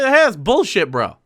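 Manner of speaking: loose, blunt bro talk; just call it out plainly; laid-back callout; casual blunt swearing. Pretend. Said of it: "just say".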